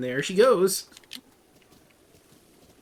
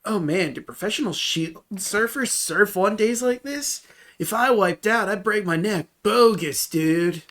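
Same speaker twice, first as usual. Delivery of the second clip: loud, higher California surfer voice; surfer dude voice; 6 semitones higher, 5 dB louder than usual. Acted. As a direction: hype it like a beach bro. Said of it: "talking like a California surfer".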